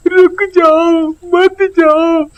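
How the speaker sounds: high, drawn-out begging. Acted. Child repeating his plea to the departing witch, slow and high. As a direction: stretch it out, begging her to stay.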